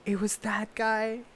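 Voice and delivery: slowed, drawn-out amazed question; mock surprise. Vlogger imitating fans discovering him, drawn-out surprise. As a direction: gasp it like a stunned fan. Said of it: "people are like".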